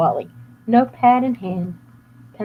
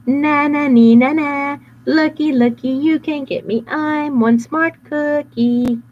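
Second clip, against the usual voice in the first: high sing-song teasing chant; slow mocking nyah-nyah; taunting cookie; 7 semitones higher, 5 dB louder, slower than usual. Cookie taunting the bad boys, high sing-song.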